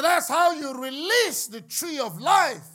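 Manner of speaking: loud, high, slow proclamation; full-force preaching. Acted. Preacher proclaiming the tree of life, full force. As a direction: draw it out as a ringing climax.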